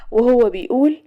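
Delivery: ordinary delivery, nothing marked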